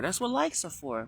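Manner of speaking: put-on high child's explaining voice; kid voice. Kid explaining the word like, high child voice. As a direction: explain it as a little kid would. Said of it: "you just did it in the kid voice".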